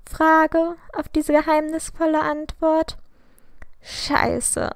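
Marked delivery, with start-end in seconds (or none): none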